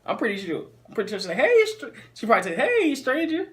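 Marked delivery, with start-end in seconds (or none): 1.4-1.9 high, louder mock-flirty greeting; playful high-pitched impersonation; imagined flirty text. 2.6-3.5 high-pitched mock-flirty greeting; teasing high impersonation of a woman's hello; imagined flirty text.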